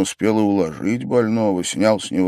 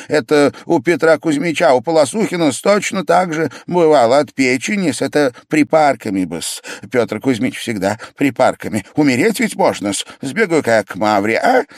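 higher-pitched eager chatter; suddenly animated; 6 semitones higher, 3 dB louder than usual. A narrator's reading. Pavel urging hot compresses on the sick man, animated. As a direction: rattle on, all worked up. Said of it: "оживился вдруг ужасно Павел Павлович".

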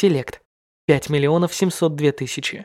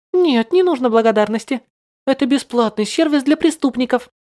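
put-on high sarcastic girlish voice; mock him sweetly from above; smug mocking girl; 8 semitones higher, 3 dB louder than usual. Girl mocks bound hero. (насмешливый высокий голос)

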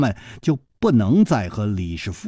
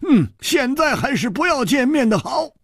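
put-on high, loud cackling old man; a jolly old elder full of gratitude; old beggar elder; 12 semitones higher, 3 dB louder than usual. A narrator's reading. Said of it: "那个苍老的声音". (苍老高亢的老者声音)

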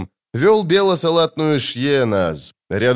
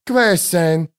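high anxious exclamation; high-pitched flustered call; agitated; 7 semitones higher than usual. A narrator's reading. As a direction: cry out in alarm. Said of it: "трясясь и от волнения переходя на диалект".

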